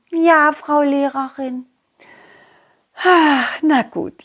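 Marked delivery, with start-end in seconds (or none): 3.0-4.2 put-on old teacher voice.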